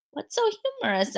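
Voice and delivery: a light sweet teacher asking; curious teacher. Acted. Teacher asking the giggling class, light and quick.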